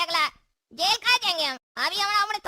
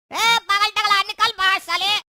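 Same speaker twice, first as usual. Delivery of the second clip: loud call-out to a rival; angry shouting; 2 semitones higher, 5 dB louder than usual. Acted. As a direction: yell a challenge at his house. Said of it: "काहे चिल्ला रहा है?". (गुस्से में चिल्लाकर ललकारना)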